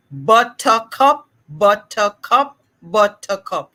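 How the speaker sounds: "buttercup" slowly, each syllable separate; measured syllable drill. Shown as a demonstration. Therapist models the drill for the host, measured syllables. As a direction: model "buttercup" in three distinct slow beats. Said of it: "Now we're gonna say it faster".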